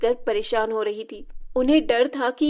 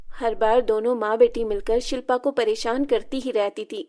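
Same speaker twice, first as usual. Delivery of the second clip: ordinary delivery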